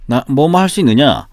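ordinary talk

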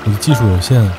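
ordinary delivery

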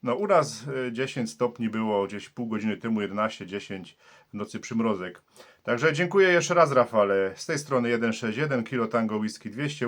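usual speaking voice

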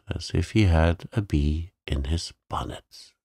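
ordinary delivery, nothing marked

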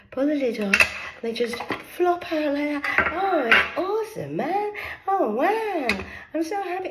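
high, giddy delight; delighted squeal. Real, not acted. Opening her tarot tin, high giddy squeals. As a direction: squeal over the new cards.

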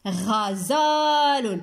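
غزال joined up with a long middle vowel; slow, connected model pronunciation; whole word, stretched. Shown as a demonstration. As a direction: blend the syllables slowly into one word. Blends syllables into whole word.

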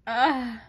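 short, eye-rolling "ugh"; exasperated groan. Real, not acted. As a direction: groan at how silly these books are. Groans at the eye-roll books.

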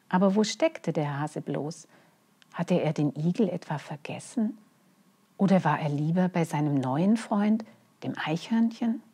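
ordinary delivery

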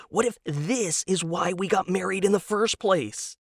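voiced husband, keen and hopeful; eager husband Tom. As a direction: pitch it up like a naive true believer. Tom's eager pitch.

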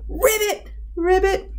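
high-pitched frog ribbits; frog croak. A narrator's reading. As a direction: chirp it high like a hopping frog. Frog hops away croaking.

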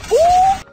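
sudden high loud ooh; high excited ooh. Real, not acted. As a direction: squeal with delight. Excited reaction to kill.

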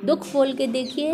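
normal talking voice

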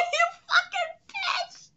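high laughing cry of delight. Real, not acted. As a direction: laugh it out in disbelief. Laughing at character's jab.

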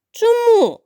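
very high, loud angry bark; angry exclamation. Acted. Crocodile angrily demanding why the duckling refuses. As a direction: snap the question back. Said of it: "сердито вигукнув Кроко".

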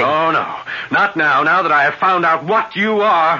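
frightened defiance. Acted. John facing the revealed vampire, frightened defiance. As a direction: push back through the fear.

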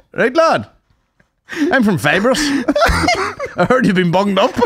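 high, laughing delivery-man voice; fibre delivery man voice. Retold or imitated. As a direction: play a chirpy local tradesman. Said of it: "there's a guy comes around".

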